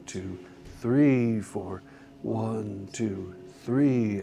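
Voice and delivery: soft counting with each "four" fading away; counting beats. Shown as a demonstration. Teacher counting the four beat pattern aloud. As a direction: count the beats in strict time. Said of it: "Switch to numbers".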